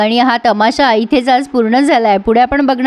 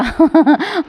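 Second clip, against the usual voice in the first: a quick real laugh at the scene; short laugh; 4 semitones higher than usual. Real, not acted. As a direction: laugh it off briefly.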